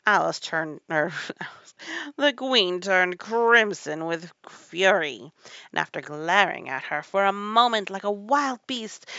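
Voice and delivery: loud narration swelling toward a scream; building fury. A narrator's reading. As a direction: build the rage with loud, stretched words.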